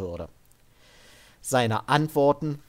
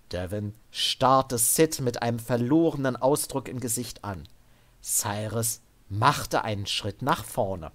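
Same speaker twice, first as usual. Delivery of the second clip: ordinary delivery, nothing marked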